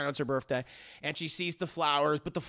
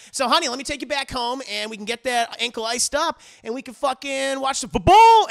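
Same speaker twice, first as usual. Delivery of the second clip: fast, high and rising to loud; mock husband eager to get home to the game; hammed-up husband; 7 semitones higher, 7 dB louder than usual. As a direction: rush it, then blurt the football line loud. Husband voiced in recap.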